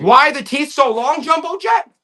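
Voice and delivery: loud, high, rising to the end; mock astonished question. Acting out visiting friends' question.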